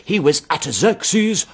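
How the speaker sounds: triumphant preacher, slowly drawn out; hammed-up slow triumph; enormous triumph. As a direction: proclaim it slowly like a pulpit revelation. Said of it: "with enormous triumph".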